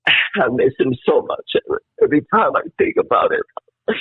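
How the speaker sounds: real tearful grief; crying. Real, not acted. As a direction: voice cracking high into real sobs. Crying about missing his friend.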